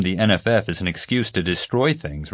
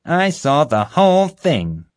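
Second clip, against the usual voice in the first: slow, high, louder accusation; emphatic accusation; 9 semitones higher, 5 dB louder than usual. A narrator's reading. Officer confronts the prisoner as witness, emphatic and accusing. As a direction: drive each word home.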